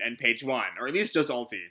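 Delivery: normal talking voice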